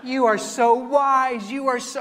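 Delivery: slow, high, loud flattery; mock gushing praise. Email writer now flattering the pastor, exaggeratedly gushing. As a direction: imitate someone gushing with admiration. Acts out lady's gushing reply.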